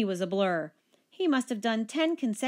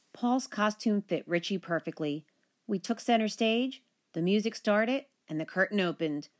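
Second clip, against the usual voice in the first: usual speaking voice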